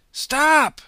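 huge high shout; full-voice shouted stop; yell. Acted. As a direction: yell after a runner. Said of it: "she yelled".

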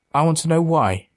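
urgent, emotional demand. A narrator's reading. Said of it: "he demanded".

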